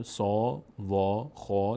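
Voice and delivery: "سا، وا، خا" with a rounded, open "aw"; full, heavy vowel. Shown as a demonstration. («سا، وا، خا» پر و درشت)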